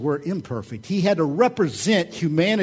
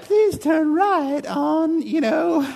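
much higher, slow, mechanical female navigation voice; GPS woman voice; 13 semitones higher, 4 dB louder, much slower than usual. Retold or imitated. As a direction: imitate a sugary satnav lady. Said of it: "it's a female voice".

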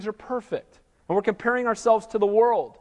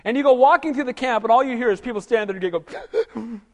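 usual speaking voice

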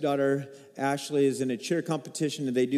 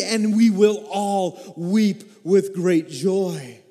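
impassioned preaching; 7 semitones higher, 4 dB louder than usual. Acted. Preaching at full force.

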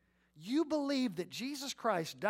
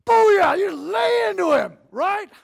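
shouted, much higher victory cry; triumphant cheer; 11 semitones higher, 18 dB louder, much slower than usual. Retold or imitated. Preacher acting out a pumped-up self-talk cheer, shouting. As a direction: yell it out like you just won.

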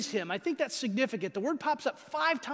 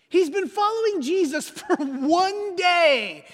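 chuckling through the words, slower and higher; amused, half laughing while telling it; 7 semitones higher, 6 dB louder, slower than usual. Real, not acted. Preacher amused by Philip.

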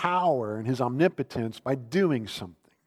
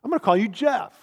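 put-on high, loud, cocky kid voice, rushed; a bratty sixth grader announcing it; mock punk kid; 7 semitones higher, 7 dB louder, faster than usual. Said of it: "some punk sixth grader just came up to me and said".

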